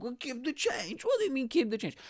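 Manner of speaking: very high-pitched, indignant disbelief; mock incredulous. Boyfriend questioning her tip to the seller, mock incredulous. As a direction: imitate him squawking the question back.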